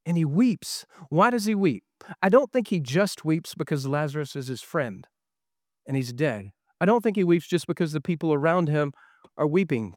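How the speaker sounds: ordinary talk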